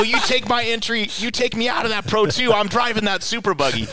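high-pitched, insistent argument; retold heated ultimatum. Driver to race boss on the phone, heated ultimatum. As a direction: lay down terms in a re-enacted quarrel.

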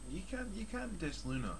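ordinary talk

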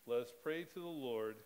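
usual speaking voice

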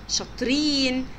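normal talking voice

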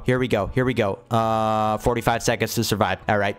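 normal talking voice

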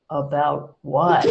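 low, slow puzzled question; puzzled mental reply. Retold or imitated. Medium retelling her silent reply to the voice, slow and puzzled. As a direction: think it aloud, slow and baffled. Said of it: "I said mentally".

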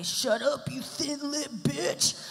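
mock troll voice. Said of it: "those trolls that say".

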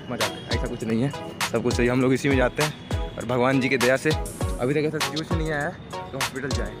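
usual speaking voice

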